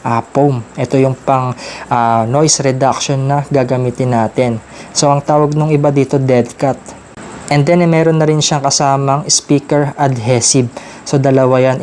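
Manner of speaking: ordinary talk, nothing marked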